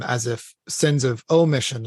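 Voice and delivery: ordinary talk, nothing marked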